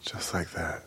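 barely voiced whisper. Real, not acted. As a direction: whisper it, eavesdropping. Listening to neighbors argue.

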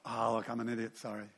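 grudging mock apology.

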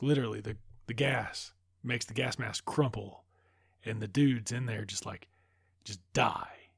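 ordinary delivery

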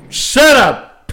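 sudden high, loud outburst; mock angry shout. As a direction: burst out yelling at the TV in mock outrage. Repeats his yell at wife.

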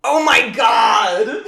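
a loud, high, drawn-out oh my God; real disbelief, stretched slowly; shocked exclamation. Real, not acted. Shocked by Biden's no soul remark.

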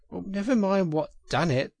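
high-pitched, pained complaint. A narrator's reading. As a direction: brush the question off through pain. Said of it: "forgetting his grammar in his pain".

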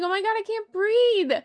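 high, loud, gasping panic, trapped underwater; mock panic as she loses her breathing tube; panicked. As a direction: yelp it like the air is running out. Panicking in the goo tank.

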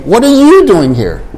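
"What are you doing here?" with the accent on "you"; stress on you. Shown as a demonstration. Teacher showing where the accent goes, stress on you. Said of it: "So try to say once".

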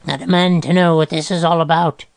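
high, strained croak; acted high croak of protest. A narrator's reading. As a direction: croak out an indignant demand. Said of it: "Groverbs croaked".

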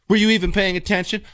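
a hint of the suspicious wife; imitating wife. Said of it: "wife's follow-up question".

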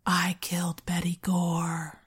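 slow, whispery creepy phone caller. Retold or imitated. Creepy caller to the victim's brother on the phone, creepy whisper. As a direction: draw it out in a sinister whisper. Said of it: "hear a creepy voice on the other end".

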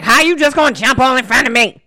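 loud, high mock outburst; angry stranger. Stranger at the gas station objects to being cut, mock angry. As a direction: imitate someone snapping about being cut in line. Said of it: "why people always gotta say something and be so angry".

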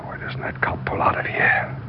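whispered worry about the cop; urgent whisper. Acted. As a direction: hiss it so the cop can't hear. Hiding from the cop.

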